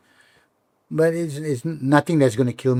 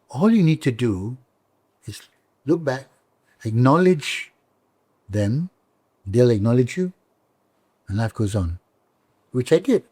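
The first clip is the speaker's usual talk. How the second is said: usual speaking voice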